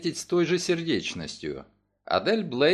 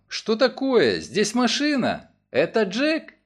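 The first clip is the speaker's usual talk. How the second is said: hammy fake surprise; 6 semitones higher, slower than usual. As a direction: overplay the astonishment like a school play. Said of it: "сцена удалась девушке примерно так же, как какой-нибудь самонадеянной школьнице Джульетта". (наигранное удивление)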